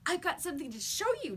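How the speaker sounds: put-on a girl calling to John across the schoolyard, excited. A narrator's reading. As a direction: call across the playground, excited. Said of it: "she shouted".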